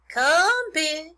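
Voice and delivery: high, loud animal call; farmer calling. A narrator's reading. Farmer lures pig with a pail, calling out. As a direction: call the pig in, drawing out the word. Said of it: "said Mister Zuckerman".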